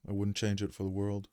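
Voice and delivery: normal talking voice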